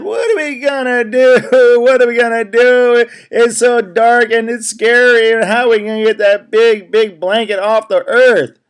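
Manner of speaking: high, frantic animal voices; panicked forest animals. Acted. Forest animals crying out in the sudden dark, panicked. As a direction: squeal it out like scared little critters.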